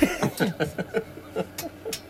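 soft unvoiced giggle rising; airy giggle; breathy giggle. Real, not acted. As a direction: laugh silently through the breath. Laughs after fuck off.

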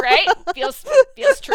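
high, loud, teary mock gush. Friend quoting herself gushing over the show, mock teary. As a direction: gush it like a moved fan. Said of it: "I was like".

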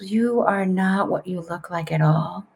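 imitating a knowing psychic; solemn psychic. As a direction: deliver a reading slowly and softly. Psychic's solemn pronouncement acted out.

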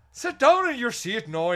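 high, loud Northern Irish teacher order. Retold or imitated. Student teacher quieting a noisy class, loud Northern Irish order. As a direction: snap at the class in a strict Northern Irish accent. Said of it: "that's Northern Ireland".

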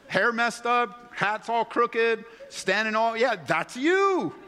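slow, high teasing list. Acted. Preacher teasing the congregation about their photo, slow and high. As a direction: drag it out, poking fun.

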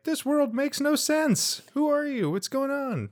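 put-on high childlike pleading voice; confused child. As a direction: play a lost child asking for answers. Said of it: "we're like".

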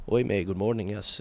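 imitation of a soft, sweet Irish accent; soft Irish guy. Imitating an Irish breakfast guest, soft sweet Irish accent. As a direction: put on a gentle, dulcet Irish lilt. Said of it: "they all speak very soft and very sweet, dulcet tones".

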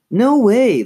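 high, loud refusal; insistent. A narrator's reading. Lindsay refuses to throw him back, loudly insistent. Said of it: "Lindsay insisted".

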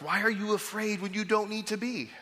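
Jesus gently asking his frightened friends; compassionate question. Shown as a demonstration. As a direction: ask it with loving concern. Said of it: "we should hear this".

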